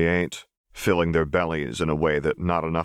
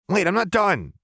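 put-on much higher, louder little girl protest; chirping girl; 4 dB louder than usual. A narrator's reading. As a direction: chirp it out with a full mouth. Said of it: "Powder chirped".

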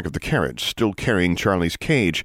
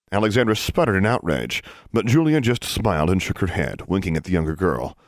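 usual speaking voice

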